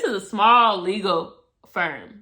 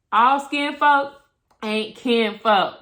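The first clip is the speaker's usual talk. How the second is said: slow, louder proverb punch; slow emphatic saying; 6 dB louder, slower than usual. Real, not acted. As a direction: land the saying word by word. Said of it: "punchline said slowly".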